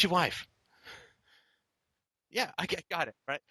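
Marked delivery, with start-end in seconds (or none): none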